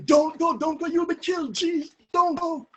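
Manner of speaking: high, desperate pleading; begging. Retold or imitated. Mother begging him not to go out, pleading. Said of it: "is begging him".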